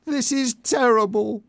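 very high, distressed voice; aghast exclamation; shocked. A narrator's reading. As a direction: reel from bad news, pitch up high.

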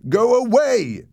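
loud, high, frightened yell; shout. A narrator's reading. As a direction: yell it to banish the ghost. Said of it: "I shouted".

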